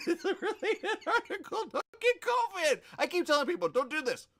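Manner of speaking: high, loud, laughing through it; giggling at the article, loud and high. Real, not acted. Laughing while reading article.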